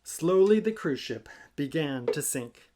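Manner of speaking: plain reading voice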